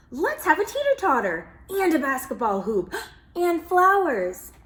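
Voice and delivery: put-on eager, rising child voice with a gasp; excited kids. Kids pitching playground ideas, excitedly. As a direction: gush out ideas like a thrilled kid.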